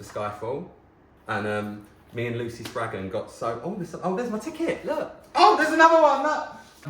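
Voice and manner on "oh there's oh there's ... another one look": high, delighted exclamations building to a loud squeal; real excited outburst, pitched right up; excited discovery. Real, not acted. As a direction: break off and squeal at a find. Spots her ticket mid-story, breaks off.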